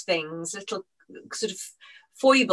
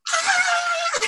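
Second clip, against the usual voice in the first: delighted shriek. Real, not acted. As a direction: scream with disbelieving delight. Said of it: "I put the phone down and I went".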